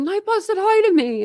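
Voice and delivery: high, thrilled mock voice; excited imitation. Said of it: "he was like".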